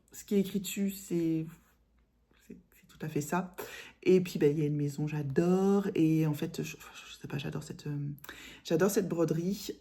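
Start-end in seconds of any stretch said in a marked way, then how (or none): 2.5-3.5 soft whisper of approval; whispered aside. Real, not acted. 6.6-8.0 soft hesitant whisper; whispered aside. Real, not acted.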